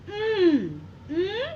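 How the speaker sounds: ऊं high, hummed "oon" through the nose; nasal pronunciation. Shown as a demonstration. Teacher isolating the first sound of camel, ऊं high and nasal. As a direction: hold ऊं as a high nasal hum. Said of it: "pronunciation किया ऊंट का".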